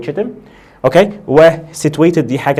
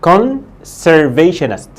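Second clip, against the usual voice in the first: conservationist stretched out very slowly; drawn-out teacher pronunciation; slow modelling; 2 semitones higher, much slower than usual. Shown as a demonstration. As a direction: spell out conservationist syllable by syllable. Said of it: "بص، اسمها".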